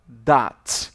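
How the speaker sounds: Dot said loud and clear; pronouncing dot. Shown as a demonstration. Teacher modelling the dot symbol, loud and clear. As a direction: say Dot firmly. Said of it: "a gente fala dot".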